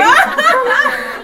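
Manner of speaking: loud, high giggle; high laugh. Real, not acted. Speaker giggling high and loud as she tells the story.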